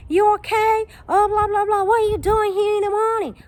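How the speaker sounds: mocking her in a high, fussy voice; high concerned voice of a stranger; imitating the kind lady. Lady asking what she's doing.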